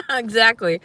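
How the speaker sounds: high laugh then a loud, laughing exactly. Real, not acted. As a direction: crack up, then agree. Laughs agreeing with chat.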